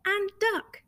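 plain reading voice

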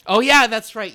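loud, high sudden realization; surprised. Real, not acted. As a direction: blurt it out as it clicks. Sudden recall of friend seeing Cats.

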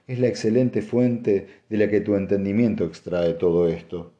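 ordinary delivery

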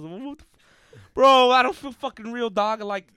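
loud, high, frantic panic; panicked yelling. Retold or imitated. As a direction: yell it like you're losing your grip on reality. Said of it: "fucking having a panic attack".

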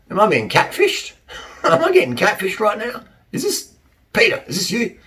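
high, rising mock-suspicious guy. Man eyeing a dating profile, mock suspicious and high. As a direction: play a baffled man fearing a catfish.

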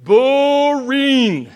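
a drawn-out, laughing boring; mock bored. Shown as a demonstration. Preacher tells kids shepherd life, mock bored. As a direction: drag the word out like a yawn. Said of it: "You ready?".

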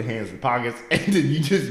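ordinary delivery

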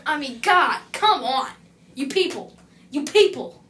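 loud, high exasperated rant; angry rant. Acted. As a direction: scold the customers who don't tip. Rant about customers not tipping.

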